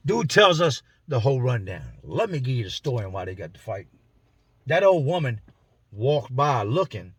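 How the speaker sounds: normal talking voice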